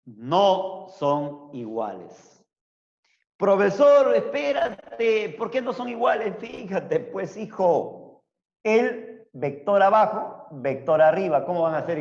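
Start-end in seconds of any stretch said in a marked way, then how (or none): 3.4-6.4 higher, louder student cutting in; student objecting. Retold or imitated. Student voiced objecting to teacher. (alumno que interrumpe al profesor, más agudo y fuerte)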